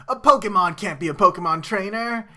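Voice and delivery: loud, high incredulous protest; imitating a scandalized challenger; mock outraged disbelief. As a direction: blurt it out as an indignant cartoon objection. Voices guy's outraged disbelief.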